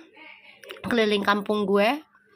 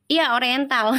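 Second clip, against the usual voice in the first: normal talking voice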